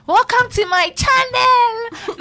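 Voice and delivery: slow, high, drawn-out channel greeting; mock YouTuber intro. Vlogger opening her video with a mock welcome. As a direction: ham up a tearful welcome to the channel.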